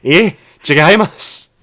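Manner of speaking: loud mock protest. Cashier refusing the overpayment, slipping into loud Japanese protest. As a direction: wave the money back.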